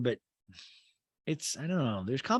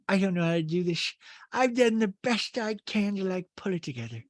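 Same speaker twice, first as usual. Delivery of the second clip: Rinna impression; 7 semitones higher than usual.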